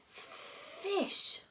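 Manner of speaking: airy, high creature hiss; hissing dragon. A narrator's reading. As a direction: hiss the word out like a hungry little dragon. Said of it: "said Toothless again".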